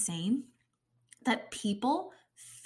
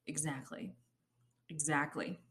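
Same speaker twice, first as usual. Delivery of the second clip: quiet aside; 8 semitones lower, 12 dB quieter than usual. Real, not acted. Quiet mock answer to silence.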